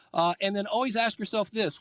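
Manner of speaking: ordinary talk, nothing marked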